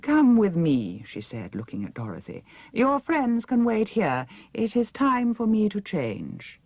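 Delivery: languid vain princess giving orders; haughty princess. A narrator's reading. Princess Mombi's voice.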